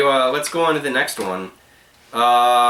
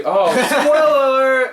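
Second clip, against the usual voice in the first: laughing exclamation; 9 semitones higher, 4 dB louder, slower than usual. Real, not acted. Mock outrage at spoiler.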